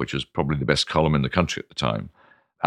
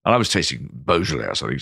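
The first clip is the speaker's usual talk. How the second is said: ordinary delivery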